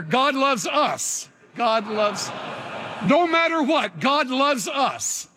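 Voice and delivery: preacher building to a peak; rousing declaration. Shown as a demonstration. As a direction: lead the congregation in a shout of faith. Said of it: "Say it like you mean it".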